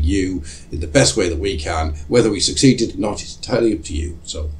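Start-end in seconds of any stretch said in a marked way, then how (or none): none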